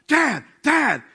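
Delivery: high, loud imitation of a kid in a panic; hammed-up frantic child calling for Dad; panicked daughter on the phone. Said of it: "I get a phone call".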